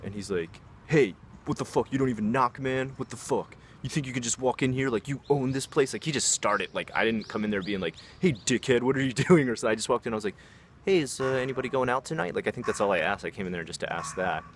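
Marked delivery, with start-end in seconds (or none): 0.9-5.9 imitating a confrontational drunk friend, raised pitch; mock indignant challenge, pitched up; aggressive, provoking. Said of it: "aggressive, provoking mode". 8.2-9.5 louder, higher voice with a laugh; hammed-up confrontational hello; playfully barge in with an insult, pitched up; mock rude greeting. Mock rude greeting he never made. 10.8-12.3 higher, friendly asking voice; imitating a harmless drop-in question; casual innocent question. His innocent question on walking in.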